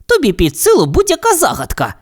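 high, sweet flattering voice; butter up the little bird; flattering cat. Acted. The cat flatters the canary, high and sweet.

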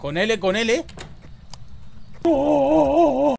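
loud high frantic screaming; yelling in terror; panicking gamer. Real, not acted. Ghost hunting, players screaming.

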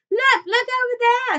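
high, loud shriek of discovery; excited scream. A narrator's reading. Veruca spots someone across the river, high excited scream. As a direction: scream to make everyone look. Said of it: "she screamed".

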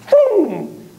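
high-pitched sound-effect boom; sudden boom. Shown as a demonstration. Therapist showing feeling suddenly injected, sudden high boom. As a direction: punch out a high, drawn boom.

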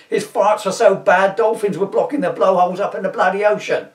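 villain yelling as he's put in the police car. Acted. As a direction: bellow it while being hauled off. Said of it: "Electrode yelling".

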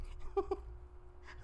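quick unvoiced giggle; high breathy laugh. Real, not acted. Tarot reader laughing at the reading, a high unvoiced giggle.

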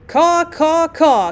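high, loud squawked caws; loud cawing. A narrator's reading. Old general protesting at the ringing, loud cawing. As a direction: squawk it out like a crow.

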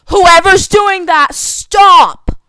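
mad, high-pitched full-volume yell; drawn-out freaked-out scream at someone; real angry shout. Real, not acted. Player to whoever keeps flicking the water bottle, real angry shout. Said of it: "I'm freaking out and I'm getting mad".

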